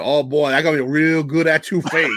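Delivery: put-on playful Cajun accent of a TV cook; hammed-up Cajun accent, easygoing and jolly; Justin Wilson Cajun accent. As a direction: imitate a folksy Cajun cook's accent. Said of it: "it would be like Justin Wilson, the Cajun cook".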